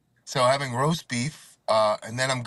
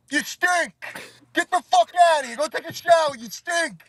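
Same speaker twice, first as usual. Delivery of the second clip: loud, high-pitched fatherly scolding; yelling Italian father; 14 semitones higher, 5 dB louder than usual. Acted. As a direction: act out dad hollering insults. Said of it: "my father be sitting there".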